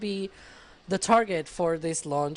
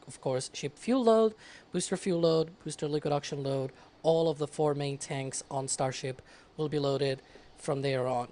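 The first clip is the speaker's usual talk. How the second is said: normal talking voice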